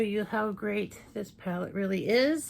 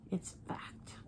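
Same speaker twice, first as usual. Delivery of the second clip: whisper; 12 dB quieter than usual. Real, not acted. Whispered aside for emphasis.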